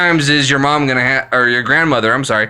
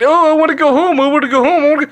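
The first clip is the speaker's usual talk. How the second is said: high, whiny mock voice, pleading; mocking whine; 12 semitones higher, much faster than usual. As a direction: mimic a lazy worker whining to go home. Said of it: "sit there and whine and complain".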